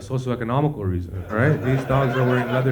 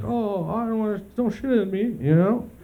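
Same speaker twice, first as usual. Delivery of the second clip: high, pleading toilet that begs not to be used; whiny toilet voice; 10 semitones higher than usual. Pretend. Friend pictured as a toilet, begging in a high whine. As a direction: play a squeamish toilet, much higher and slower. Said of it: "now you're the toilet".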